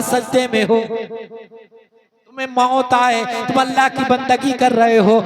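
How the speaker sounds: loud, insistent sermon exhortation on a sustained pitch; full-force preaching. Acted. Preacher urges congregation to pray for a good death, full-force preaching. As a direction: drive each repeated plea home.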